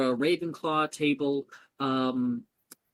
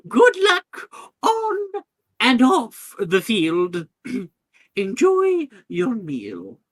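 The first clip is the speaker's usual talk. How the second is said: slow, loud, higher send-off to the hall; headmistress; 10 semitones higher, 10 dB louder, much slower than usual. Acted. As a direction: proclaim it slowly as the headmistress.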